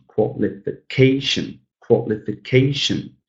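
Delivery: "qualification" slowly by syllables, CA stretched; slow teacher demo of "qualification", second-to-last syllable strongest; stress on penultimate syllable. Shown as a demonstration. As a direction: break up "qualification" and lean on CA. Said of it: "the penultimate syllable is stressed".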